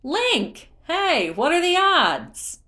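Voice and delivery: high, loud, delighted cry across the road; excited call. A narrator's reading. Beetle spotting Link across the road, calling out with loud delight. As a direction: call out an old friend's name, thrilled. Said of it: "A familiar voice cried".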